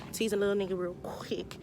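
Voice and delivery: whispered, breathy aside. Shown as a demonstration. Telling viewers how she teased him, whispered. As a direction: whisper it slyly to the listener.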